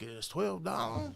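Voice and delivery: Weasel voice. Retold or imitated. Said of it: "it'll have the voice in it for you".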